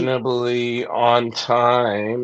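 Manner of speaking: slow, stretched-out host introduction; drawn-out introduction. Real, not acted. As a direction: announce the guest's arrival, drawing out each word. Host introduces an arriving guest.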